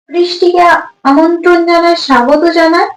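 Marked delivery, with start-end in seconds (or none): none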